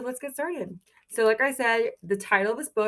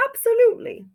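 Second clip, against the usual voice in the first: much higher and louder, an eager hen's answer; 8 dB louder than usual. Acted. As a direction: pop it out high like a keen little chicken. Hen character answers in story.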